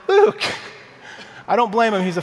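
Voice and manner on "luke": sudden high, loud yelp of a name; exasperated outcry. Retold or imitated. A dad yelping at his son over ice cream, high and loud. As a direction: cry out the boy's name in mock dismay. Dad reacts to son's cone trick.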